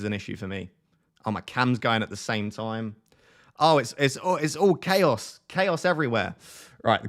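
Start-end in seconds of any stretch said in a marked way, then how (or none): 3.6-6.3 high, drawn-out chaos; panicked, slowing over the words; flustered. Real, not acted. Camera and site going together.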